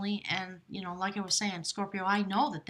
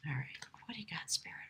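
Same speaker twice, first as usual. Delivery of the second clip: whispered aside; 5 semitones lower, 12 dB quieter, faster than usual. Real, not acted. Quietly asking Spirit.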